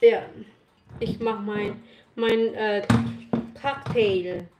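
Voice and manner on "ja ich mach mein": ordinary talk, nothing marked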